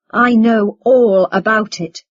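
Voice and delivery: loud, slow, weighty delivery; slow and emphatic. A narrator's reading. Son turns to mother about father, slow and emphatic. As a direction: land each word slowly and forcefully. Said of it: "said slowly and emphatically".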